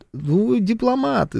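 usual speaking voice

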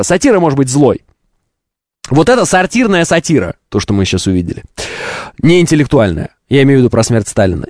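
ordinary talk

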